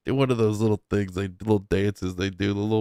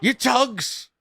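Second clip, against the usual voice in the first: mock Irish accent; 13 semitones higher than usual. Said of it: "echoes the Irish man's line".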